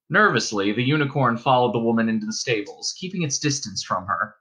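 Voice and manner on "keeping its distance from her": plain reading voice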